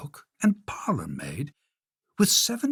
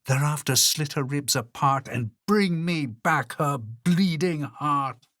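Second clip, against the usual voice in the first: a cruel queen giving a brutal command; angry shout; 5 semitones higher than usual. Acted. Queen ordering the huntsman to kill the girl, shouting. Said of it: "She shouted at him".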